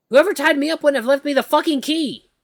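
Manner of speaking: high, loud cursing panic; chained up and furious at the captor; panicked outburst. Acted. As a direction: rant in sheer panic.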